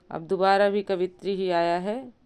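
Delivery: ordinary delivery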